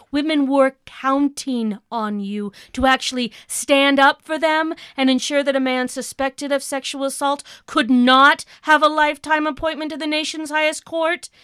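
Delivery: ordinary talk, nothing marked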